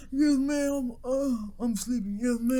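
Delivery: lightly acted half-asleep teenager; feigned sleepiness. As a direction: pretend you were just woken up. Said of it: "pretending to sleep".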